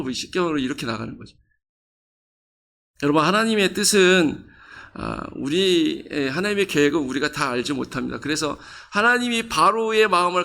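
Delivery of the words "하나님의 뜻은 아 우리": ordinary delivery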